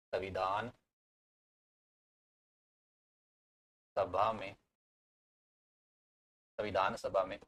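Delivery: soft, word-by-word dictation; low-key, measured note-giving; slow dictation. Real, not acted. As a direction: dictate in small pieces with long pauses. Dictating word by word while writing.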